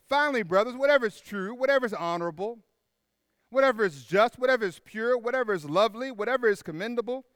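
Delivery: loud, high, fast-building scripture reading; scripture read with fervour. Acted. Pastor reading Philippians to the church, proclaiming loudly. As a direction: proclaim each whatever like a list of treasures.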